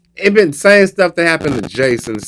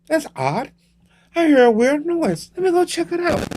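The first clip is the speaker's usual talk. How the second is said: high, soft, wondering voice; hammed-up clueless curiosity; naive horror victim; 4 dB quieter than usual. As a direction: muse to yourself in a small high voice. Horror victim wonders about a noise.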